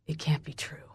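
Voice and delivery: very soft, stunned disbelief; hushed shock; whisper. A narrator's reading. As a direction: whisper it, barely audible. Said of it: "he whispers".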